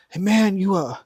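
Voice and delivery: lightly acted offended kid; mock complaint. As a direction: complain as if mildly affronted, starting high. Acts out offended Gen Z kid.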